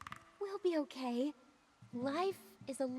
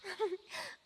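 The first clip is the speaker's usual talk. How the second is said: light laugh; 7 semitones higher than usual. Acted. Melody amused by Momo's compliment.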